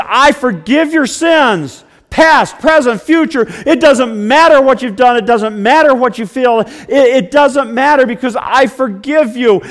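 loud, high, booming voice of God; fervent preaching voice of God. Acted. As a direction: proclaim God's words at full force.

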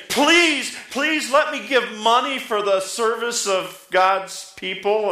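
high-pitched, drawn-out mock pleading; begging. Preacher imagining people begging to give money, mock pleading. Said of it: "People begging, say".

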